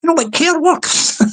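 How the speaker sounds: normal talking voice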